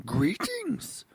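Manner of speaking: slow, croaky mock Yoda; hammed-up little Yoda bit; poor man's Yoda. Host riffing on game news as Yoda, mock Yoda. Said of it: "a poor man's Yoda I was doing".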